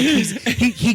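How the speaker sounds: ordinary delivery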